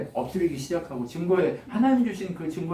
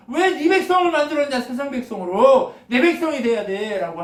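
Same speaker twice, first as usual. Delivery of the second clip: jealous God's cry; 7 semitones higher, 4 dB louder than usual. Acted. Jealous God pleading in sermon.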